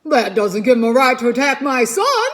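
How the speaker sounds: loud, angry holler. Acted. Father beaver hollers at Roz about the pike attacking his son. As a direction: shout it in defence of your son. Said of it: "hollered Mister Beaver".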